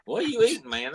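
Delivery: high eager question about food; surprised. Real, not acted. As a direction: perk up at the smell.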